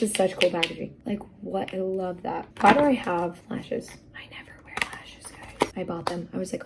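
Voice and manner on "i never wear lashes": quick secret whisper to the viewers; whispered aside. Real, not acted. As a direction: let them in on a secret. Confessional aside.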